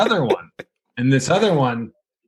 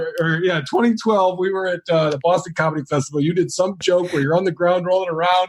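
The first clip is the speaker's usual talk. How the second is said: higher, smug jeering voice of an online troll; mocking troll; 6 semitones higher than usual. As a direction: sneer it like a know-it-all heckler. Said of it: "He's like".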